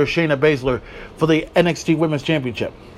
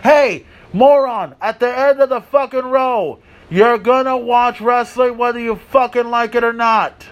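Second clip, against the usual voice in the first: yelling at a moron; 6 semitones higher, 3 dB louder than usual. Real, not acted. Yells at a heckler.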